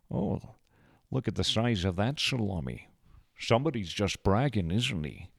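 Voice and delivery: celebrity impression with a put-on accent; playful impersonated leading man; Connery. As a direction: toss it off as a jokey film star impersonation. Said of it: "doing the Connery voice all the time".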